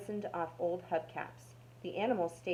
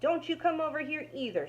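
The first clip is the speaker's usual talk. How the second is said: higher, louder shout back; 9 semitones higher, 4 dB louder than usual. A narrator's reading. Rat shouts back at Dog across the junkyard, shouting. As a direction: fling the words back across the yard. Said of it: "shouted Rat".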